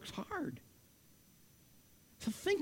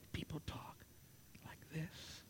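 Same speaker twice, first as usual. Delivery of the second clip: hushed whisper with almost no voice; 6 semitones lower, 22 dB quieter, slower than usual. Shown as a demonstration. Preacher showing congregation how people speak under communism, whispered. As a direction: whisper it as if someone might overhear.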